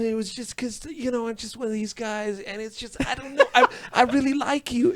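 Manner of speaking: high, soft slacker mumble; high hesitant actor impression, half laughing; David Arquette impression. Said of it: "With, with a weird accent".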